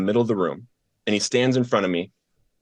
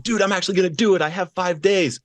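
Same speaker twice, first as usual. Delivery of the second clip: higher and fast, eager retold excitement; excited; 9 semitones higher than usual. Telling Brendan at breakfast he has five days, excited. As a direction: gush it out fast and high. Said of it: "I'm all excited".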